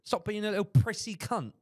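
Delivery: high, loud exaggerated British accent; mock British grandma. British grandma berating her frightened grandson, hammed-up British accent. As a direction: scold like a foul-mouthed British granny. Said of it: "She's British?".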